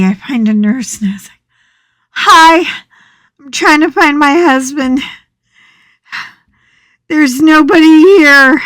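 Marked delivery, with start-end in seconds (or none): 2.1-8.6 loud, high, flustered complaint; frantic. Retold or imitated. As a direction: demand answers from the nurse, rattled. Frantic wife confronts nurse.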